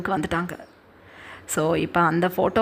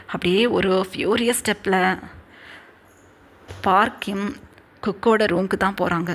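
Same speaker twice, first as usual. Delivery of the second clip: ordinary talk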